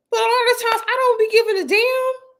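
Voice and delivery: a cartoonish high "don't care" voice; squeaky carefree voice.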